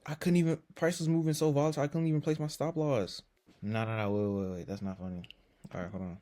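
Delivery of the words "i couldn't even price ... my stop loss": higher, louder, fast frantic outburst; panicked trader blurting it out in a rush; flustered panic. Real, not acted. Trader protesting that price moved too fast, flustered. Order fills before stop loss is placed.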